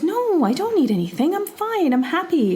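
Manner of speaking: high, lilting polite refusal; contented fisherman. Acted. Fisherman turning down the magic fish's wish, high and lightly acted. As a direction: lightly play a fisherman waving away an offer. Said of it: "And he said".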